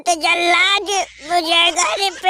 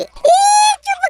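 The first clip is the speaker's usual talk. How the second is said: much higher, louder barked "ए"; sudden shout; 5 dB louder, slower than usual. Acted. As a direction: snap to silence someone. (ऊँची, तेज़ डाँटती आवाज़)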